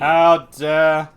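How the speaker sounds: loud mock outrage, punched. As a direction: blurt it out in loud fake indignation. Repeats indignation louder.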